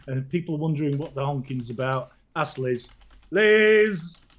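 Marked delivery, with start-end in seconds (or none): 3.3-4.3 calling out. Real, not acted.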